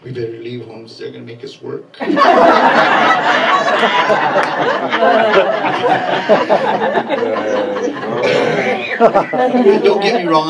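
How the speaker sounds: ordinary talk, nothing marked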